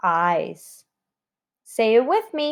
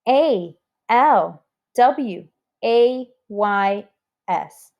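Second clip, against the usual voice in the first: slow, letter-by-letter spelling aloud; 3 semitones lower, slower than usual. Shown as a demonstration. Teacher spelling always for the class, slow letter by letter. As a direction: space out each letter for the class. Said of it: "Can you spell the word always with me?".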